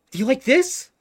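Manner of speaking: very high, loud and eager question; excited shopkeeper. Acted. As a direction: thrust the dress forward beaming. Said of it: "immediately run over and just".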